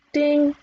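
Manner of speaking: high, ringing "ding"; imitated bell ding. A narrator's reading. Narrator voicing the round bell, high ding. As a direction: sound the bell with the voice.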